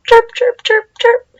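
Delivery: high, loud chirping; bird chirp. Shown as a demonstration. Teacher shows kids how birds chirp, high chirping. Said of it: "Maybe some chirp like this".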